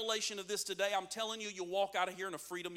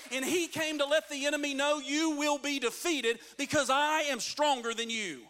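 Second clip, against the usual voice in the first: loud, raised pulpit proclamation; full-force preaching; 5 semitones higher, 4 dB louder than usual. Real, not acted. Preacher declaring Jesus a warrior king, at full force. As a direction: drive each phrase home at high volume.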